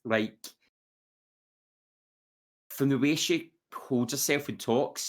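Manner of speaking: normal talking voice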